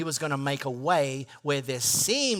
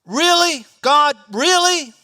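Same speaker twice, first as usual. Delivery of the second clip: high, loud incredulous cry; exasperated plea to God; 10 semitones higher, 11 dB louder, slower than usual. Pretend. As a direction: complain up to heaven. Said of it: "relational challenges".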